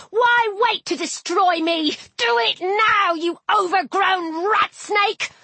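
high-pitched goading cry; yelled taunt. A narrator's reading. Heroine taunting the serpent to draw its attack, high yell. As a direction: goad the enemy, pitched well up. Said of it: "I yelled".

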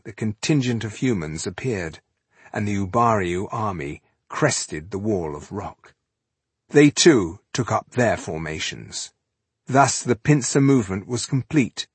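usual speaking voice

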